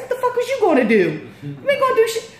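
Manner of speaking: high, loud mocking taunt with a laugh; giving attitude. Woman taunting the street crowd, loud laughing attitude. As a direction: taunt them, laughing at the threat. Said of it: "I'm just giving everybody attitude".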